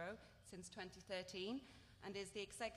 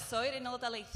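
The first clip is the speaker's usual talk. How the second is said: a proud, louder line in native Welsh; speaking Welsh; 4 semitones higher, 10 dB louder, faster than usual. Shown as a demonstration. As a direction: show off your first language, Welsh. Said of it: "I would love to hear you do that".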